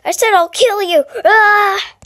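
high, loud play threat; hammed-up attack cry. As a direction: yell it as a pretend battle cry. Pretend attack between plushies.

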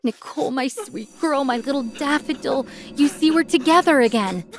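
lightly acted tearful reunion; sobbing. A narrator's reading. Father hugs the girl he calls his daughter, sobbing. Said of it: "started sobbing. At the same time, he was saying".

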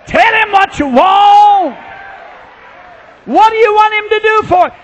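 high, loud preacher's shout; shouted exhortation. Real, not acted. Preacher urging people to ask God, shouting. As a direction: belt it out to the congregation.

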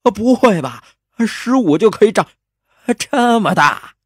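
very high, loud comic gasp of surprise; hammed-up shock; astonished disbelief. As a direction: exclaim incredulously at the top of your pitch. Hero astonished by her age.

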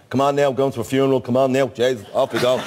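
a hint of an Irish aunt rounding everyone up; Dublin relative voice. Relative calling the family to a funeral, lightly Dublin.

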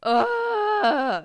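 loud, high, drawn-out cry; frustrated wail. Real, not acted. Player faces a very long grind, frustrated wail. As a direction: wail at the endless wait. Frustrated that game takes forever.

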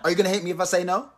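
ordinary talk

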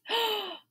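put-on a big playful gasp for the kids; mock gasp. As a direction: gasp as if shocked. Discovering the different house.

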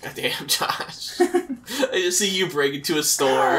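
laughing through the words, slowly; amused disbelief, laughing. Real, not acted. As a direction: chuckle while teasing your friend. Laughing at friend.

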